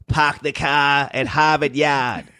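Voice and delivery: slow, R-dropping Boston accent. Shown as a demonstration. Demonstrating Boston talk for a friend, exaggerated Boston accent. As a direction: lay on a broad, drawn-out Boston accent. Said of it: "In Boston, they just like".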